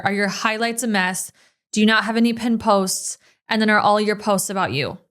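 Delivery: ordinary delivery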